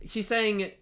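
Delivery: usual speaking voice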